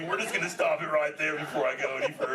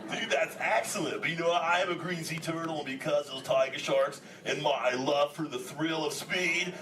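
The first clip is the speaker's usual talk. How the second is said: ordinary delivery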